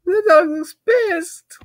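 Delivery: ordinary talk, nothing marked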